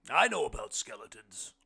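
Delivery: very loud character voice; big-voiced guest; hearty blind man. A narrator's reading. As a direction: boom it out with assurance. Blind man's line in story.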